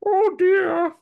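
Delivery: very high, loud mock dismay; emoji-like reaction. Describing the woman's pose in the photo, high emoji-like reaction. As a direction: squeal it like a cartoon emoji. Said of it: "I don't know emoji, like".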